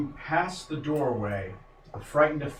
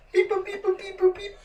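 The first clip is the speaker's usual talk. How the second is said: high robotic beep-boop. Pretend. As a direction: chirp it like a pocket calculator. Imitates calculator beeping.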